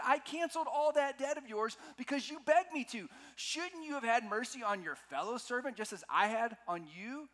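high, loud indignant scolding; acted outrage at high pitch; angry master. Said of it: "In anger, his master".

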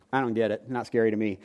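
ordinary delivery

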